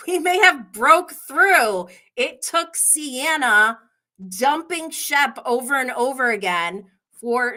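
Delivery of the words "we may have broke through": excited. Retold or imitated. Her excited reaction to Shep.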